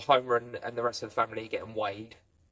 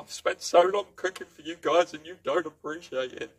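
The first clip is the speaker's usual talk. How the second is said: mock crying alien; 6 semitones higher, 4 dB louder than usual. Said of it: "he's crying and he's wiping a hanky".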